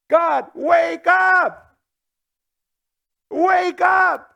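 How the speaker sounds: loud, slow, desperate cry to God; shouting at God. Retold or imitated. The psalmist crying out to a sleeping God, loud and desperate. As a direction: yell it up to heaven to wake him. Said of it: "don't you like the language that he uses".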